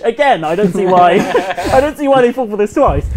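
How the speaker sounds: high, loud and laughing; amused disbelief breaking into laughter. Real, not acted. Laughing at Romans' gullibility.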